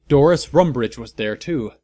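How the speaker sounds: ordinary delivery, nothing marked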